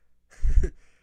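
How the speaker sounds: sudden loud breathy laugh; burst of airy laughter. Real, not acted. Streamer laughing at a [name] photo, loud airy burst. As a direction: cracks up out loud.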